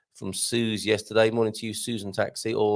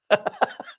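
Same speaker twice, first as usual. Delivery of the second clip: laugh; 10 semitones higher than usual. Real, not acted. Host laughs at nodding off joke.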